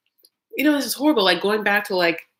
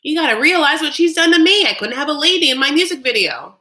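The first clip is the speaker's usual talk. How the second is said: loud, high mock outburst; indignant protest; 9 semitones higher, 7 dB louder than usual. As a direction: act out an aggrieved husband pleading his case. Acted protest in brother's argument.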